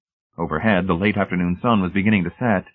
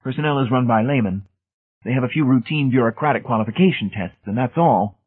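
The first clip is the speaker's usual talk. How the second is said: higher-pitched technician voice; dismissive technician, pitched up; Brady's group member; 5 semitones higher than usual. A narrator's reading. Technicians turning Hamilton away, dismissive.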